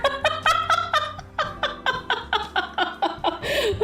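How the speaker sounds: long high cackle; uncontrolled rattling laughter; laughing. Real, not acted. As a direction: collapse into helpless high giggles. Cracking up at own voice.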